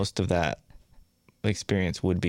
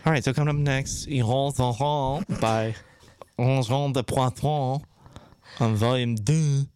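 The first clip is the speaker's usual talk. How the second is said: higher-pitched Quebecois radio voice; Quebecois accent; 4 semitones higher than usual. Pretend.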